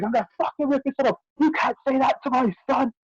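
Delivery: imitating the furious mum; angry mum. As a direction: rattle it off like a raging mother. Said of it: "she goes".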